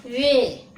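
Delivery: "वे" held long, loud and falling; long e vowel. Shown as a demonstration. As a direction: stretch "वे" out firmly. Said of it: "कैसे बोलेंगे". ("वे" को ज़ोर से, लंबा खींचकर)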